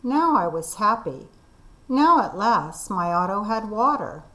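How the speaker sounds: ordinary delivery, nothing marked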